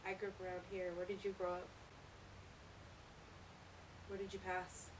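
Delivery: hushed, nervous whisper to the dark; jumpy whispering ghost hunt. Real, not acted. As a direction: whisper questions to a spirit. Hushed EVP session in back room.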